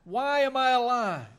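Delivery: mild preacher's cry of Job's despair; understated retold outcry. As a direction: cry it out slightly, as if quoting Job. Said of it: "he cries out".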